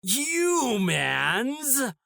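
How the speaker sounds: "Humans?" pitched high and swooping, as a mock of the bad reading; misplaced emphasis. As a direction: mimic the odd stress on "Humans?". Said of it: "you're putting the wrong emphasis on the wrong syllable".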